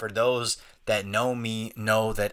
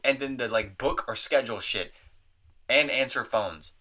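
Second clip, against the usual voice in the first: ordinary delivery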